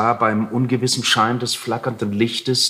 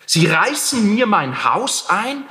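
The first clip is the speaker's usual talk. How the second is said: outraged cry; 7 semitones higher, slower than usual. A narrator's reading. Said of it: "schrie der Alte".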